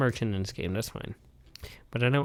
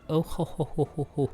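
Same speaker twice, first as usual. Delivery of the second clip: anime laugh; 5 semitones higher than usual. Acted. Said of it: "as all the anime people do".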